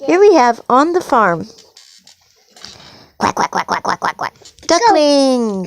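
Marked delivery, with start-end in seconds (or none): 3.2-4.3 rapid low imitated quacks; duck quacking. Parent showing a child duck sounds, playful quacking. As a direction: quack in a quick string like a duck.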